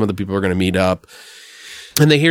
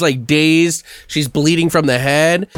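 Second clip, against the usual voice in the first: normal talking voice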